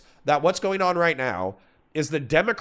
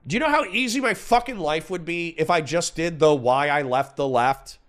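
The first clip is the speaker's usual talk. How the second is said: ordinary talk, nothing marked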